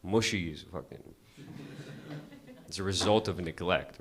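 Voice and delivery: ordinary delivery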